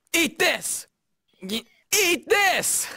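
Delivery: high-pitched battle call; attack shout. Acted. Game fighter attacking an enemy, high shout. Acted attack line in recording session.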